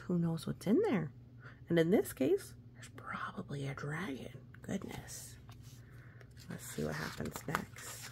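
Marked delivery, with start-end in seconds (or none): 2.7-8.1 soft, hushed whisper; hushed aside about the dragon; scary whisper. A narrator's reading. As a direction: whisper the danger to the kids. Dragon in cave, unvoiced.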